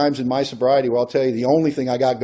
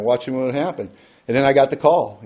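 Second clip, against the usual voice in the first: usual speaking voice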